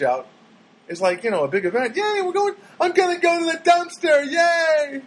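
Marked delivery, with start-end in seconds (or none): 2.0-5.0 put-on high, loud childlike cheer; mock excitement. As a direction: cheer like it's a huge outing. Mock celebrating a small outing.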